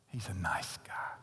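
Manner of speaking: hushed, secretive whisper. Acted. Preacher mocking the church defending sin, hushed whisper. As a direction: whisper like you're protecting a bad friend.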